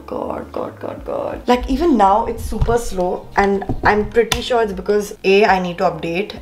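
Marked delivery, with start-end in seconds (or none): 0.1-1.5 whispered God, God under the breath; exasperated whisper. Real, not acted. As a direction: breathe it out in frustration. Computer slow, frustration.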